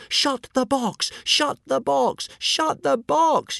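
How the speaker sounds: high, drawn-out desperate yell; loud high panicked shouting. A narrator's reading. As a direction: yell it over and over, frantic. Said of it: "yelled Hiccup".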